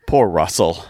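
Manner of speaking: ordinary talk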